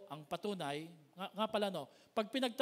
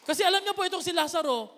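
much higher and louder preaching; preaching at full force; 10 semitones higher, 14 dB louder, faster than usual. Acted. Preacher rousing the church about Lazarus, shouting. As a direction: thunder it to the congregation.

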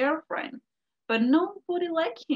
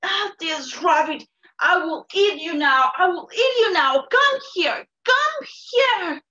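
loud, high enraged bellow; furious screaming; 4 semitones higher, 7 dB louder than usual. Acted. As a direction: scream for the rabbit to come down. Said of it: "started screaming".

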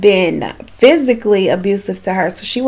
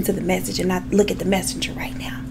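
aside whisper; 8 dB quieter, faster than usual. Real, not acted. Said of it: "I probably look a little too crazy".

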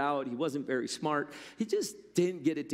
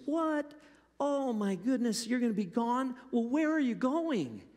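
much higher, startled servant voice; surprised Stick Man; 9 semitones higher than usual. Acted. Stick Man gasps at the master's leaving, much higher voice. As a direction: play the simple servant, high and astonished.

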